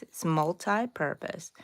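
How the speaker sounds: ordinary delivery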